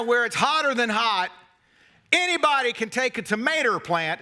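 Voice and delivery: ordinary talk, nothing marked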